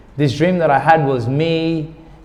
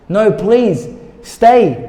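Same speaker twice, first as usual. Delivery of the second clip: high, raised begging in a retold dream; pleading, rising higher and louder on the last word; desperate plea; 7 semitones higher, 5 dB louder, much slower than usual. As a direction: beg someone not to leave. Begging Sarah to stay in dream.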